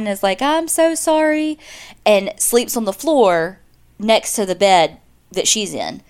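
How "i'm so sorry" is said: high, slow mock sob of a crying man; a hammed-up tearful apology; imitating crying. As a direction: whimper it out in a lightly bawling voice. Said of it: "starts bawling, crying".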